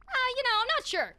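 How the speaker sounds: high, loud, modest reply; bashful character voice. Acted. Freeze answers in a character voice.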